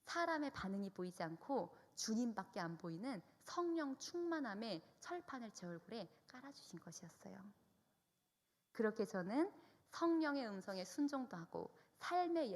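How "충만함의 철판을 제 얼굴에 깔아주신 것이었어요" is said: hushed, awed whisper. Real, not acted. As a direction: breathe it as a secret. Testimony climax. (속삭이듯)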